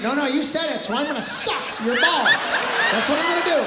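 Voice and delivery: loud, high, emphatic insistence; insistent teacher. Acted. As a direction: call the kid's bluff at full voice. Acted teacher calling pupil's bluff.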